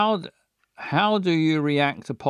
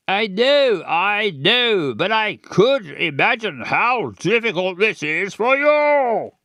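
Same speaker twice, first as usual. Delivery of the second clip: louder, rising into an angry reply; getting mad; 4 semitones higher, 4 dB louder than usual. Acted. Posh father rising in anger at his daughter. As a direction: push back hard at him. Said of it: "Let's get mad".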